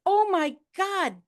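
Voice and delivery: high, drawn-out cry of amazement; amazed exclamation. Retold or imitated. Seeing her late cousin, amazed exclamation. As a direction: retell it as a high, awed exclamation. Greets dead cousin she sees.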